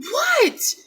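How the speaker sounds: very high mock-startled cry; startled shout. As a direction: shoot the pitch way up in retold alarm. Startled reply to mother's scream.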